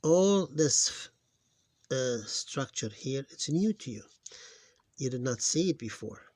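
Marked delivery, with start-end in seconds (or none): none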